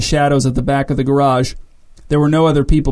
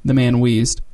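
ordinary delivery, nothing marked

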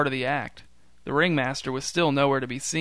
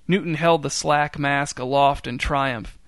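plain reading voice

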